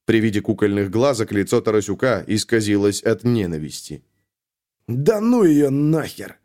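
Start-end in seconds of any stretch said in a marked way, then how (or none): 4.9-6.4 choked, slow hateful hiss; strangled hiss through teeth. A narrator's reading. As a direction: force it out through clenched teeth. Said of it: "задушенно процедил он".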